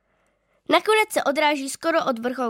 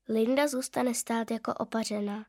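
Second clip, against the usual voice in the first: plain reading voice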